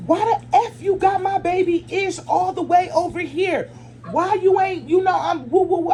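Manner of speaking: high-pitched indignant telling-off; worked-up, high angry rant; cussing out. Retold or imitated. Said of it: "I'm cussing y'all like".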